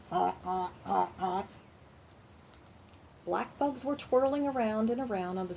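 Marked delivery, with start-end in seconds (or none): 0.1-1.5 playful honking like a goose; goose honks. Shown as a demonstration. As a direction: honk it out like a goose. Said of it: "Can you make those noises?".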